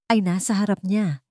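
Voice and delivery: plain reading voice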